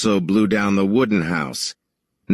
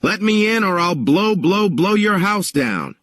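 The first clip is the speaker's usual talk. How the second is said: high-pitched threatening wolf; big bad wolf; 8 semitones higher than usual. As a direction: menace the pig from outside the door. Wolf threatens at pig's door.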